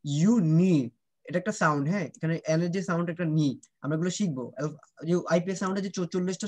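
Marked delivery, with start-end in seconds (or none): 0.0-0.9 ইউনি drawn out slowly, stressing নি; slow ইউ, then stressed নি. Shown as a demonstration. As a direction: slowly model uni with weight on নি. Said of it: "এখানে একটা stress হবে".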